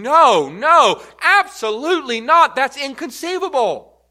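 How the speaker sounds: loud, high, forceful denial; emphatic refusal. Retold or imitated. Preacher voicing Paul rejecting the question, emphatic refusal. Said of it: "saying no to the strongest way".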